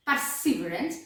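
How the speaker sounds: usual speaking voice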